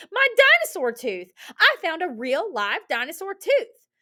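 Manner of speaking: high, loud, thrilled story voice; Benny whooping. Acted. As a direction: crow over an amazing find, easing slightly. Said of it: "Benny whooped".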